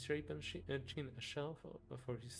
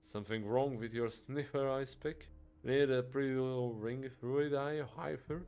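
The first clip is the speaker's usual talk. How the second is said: loud, sneering insults; a loud, mocking doorman trading insults; jeering thug; 3 semitones higher, 7 dB louder than usual. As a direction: jeer the insults right in his face. Scally insults the minotaur.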